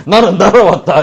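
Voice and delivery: high, loud mock bragging; boastful cry. Child bragging of being blown out the gate, loud. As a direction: proclaim it like a war story.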